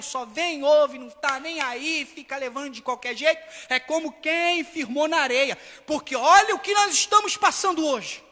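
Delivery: impassioned pulpit proclamation; full-force preaching. Real, not acted. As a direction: preach it forcefully, stretching the key words.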